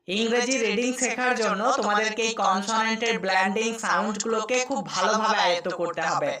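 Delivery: ordinary talk, nothing marked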